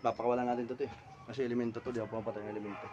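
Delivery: low, soft explanation; quiet aside. Real, not acted. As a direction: explain discreetly to those nearby. Explains decision aside to onlookers.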